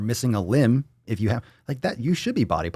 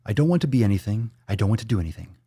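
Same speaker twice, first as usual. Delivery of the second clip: usual speaking voice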